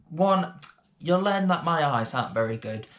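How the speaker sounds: usual speaking voice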